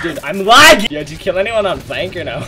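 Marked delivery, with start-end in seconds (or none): 0.0-0.9 loud annoyed yell; frustrated shout. Real, not acted. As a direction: shout about the lag. Complains about lag mid-fight.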